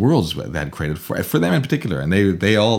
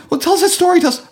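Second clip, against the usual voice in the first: put-on much higher, pleading child voice; begging kids; 6 dB louder, faster than usual. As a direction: whine like eager kids begging for more.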